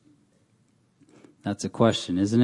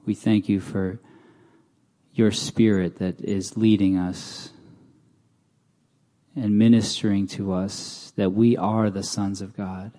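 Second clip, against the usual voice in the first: soft, reverent prayer voice; closing prayer; 2 semitones lower, 5 dB quieter than usual. Acted. Pastor closes the evening praying for the church, soft and reverent. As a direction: pray quietly over the church.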